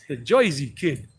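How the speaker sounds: put-on New Jersey accent, "Jersey" as "Joisey". Shown as a demonstration. Talking like to his brother out in Jersey, Jersey accent. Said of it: "I got a different one when I'm talking to my brother".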